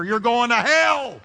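high, loud, drawn-out roar; thunderous warning. Acted. Preacher voicing God's warning to the stubborn, thundering. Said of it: "a voice of warning like thunder".

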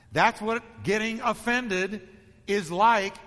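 ordinary delivery

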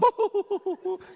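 high laugh burst; short high laugh. Real, not acted. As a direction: laugh out at the memory. Audience-facing laugh.

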